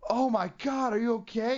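alarmed fuss. Retold or imitated. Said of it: "you're not supposed to be like".

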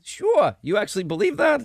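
high, disbelieving "Sure?" thrown back; mock her answer back in disbelief; incredulous. Real, not acted. Host teasing his co-host, incredulous and high. Host doubts co-host's angel belief.

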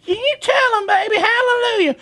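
very high, mock church wife cheer. Wife egging on the preacher, very high mock cheer. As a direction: squeal it way up like the wife in the background.

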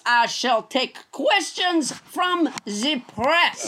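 mock French accent as Trump; pompous French-accented Trump voice. Comedian playing French Trump at his press conference, mock French accent. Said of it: "it is I, French Trump".

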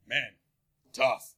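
terse deadpan response with a long pause; deadpan sympathy. Retold or imitated. Imitating a laconic friend hearing your world's problems, deadpan. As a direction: imitate a man offering minimal sympathy. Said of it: "you just go to him with your world's problems and he goes".

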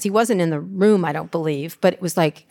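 normal talking voice

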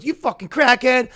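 high-pitched imitation of a parting jab; mock angry insult. Retold parting insult after the punches.

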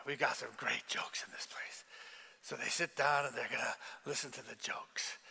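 unvoiced, hushed telling; preacher hushing the congregation mid-story; whispered aside. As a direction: lean in and whisper it.

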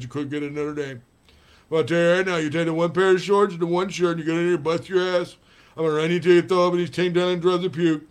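put-on loud lisping old coach voice; fired-up Lou Holtz rant; Lou Holtz impression. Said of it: "Lou Holtz is here with a hair lip".

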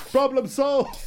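high, pleased "problem solved"; mock triumphant. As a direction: announce it like a genius. Absurd zoo solution joke.

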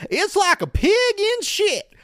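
high, loud, slow exaggerated country accent; put-on country accent. Imitating Jasmine in the diary room, exaggerated country accent. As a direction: belt out a hammed-up country accent, stretched slowly. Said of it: "really puts that accent on".

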